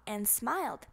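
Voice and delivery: ordinary delivery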